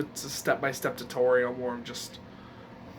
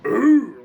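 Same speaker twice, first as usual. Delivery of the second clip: loud gravelly "ooh", pitched up; Thwomp groan; 7 semitones higher, 11 dB louder than usual. Shown as a demonstration. Demonstrating a Thwomp impression to viewers, loud gravelly groan. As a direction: force out a rough, rumbling groan. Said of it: "a thwomp impression".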